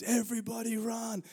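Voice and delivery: high, held-back mock shout; overheard yell. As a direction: imitate a warning yell heard from afar. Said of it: "next minute he hears".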